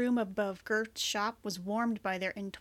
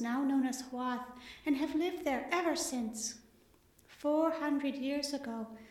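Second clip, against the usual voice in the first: hollow voice; 5 semitones higher than usual. Acted. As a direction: read it out hollow and echoing. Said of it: "The librarian's hollow voice filled the hall".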